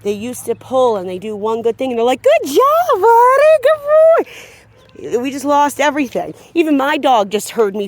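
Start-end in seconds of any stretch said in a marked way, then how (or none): 2.2-5.2 high, loud gushing dog praise; imitating owners who get all excited; over-excited praise. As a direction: gush over the dog like he's a hero. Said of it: "if you get all excited".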